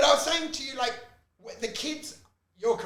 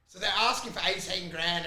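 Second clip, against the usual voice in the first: normal talking voice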